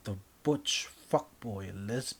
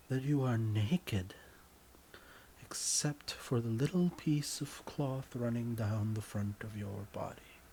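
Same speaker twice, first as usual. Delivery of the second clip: usual speaking voice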